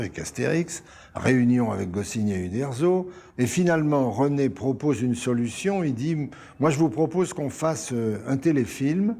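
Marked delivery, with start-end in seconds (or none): none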